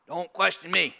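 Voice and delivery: mock stern God. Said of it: "never once he say".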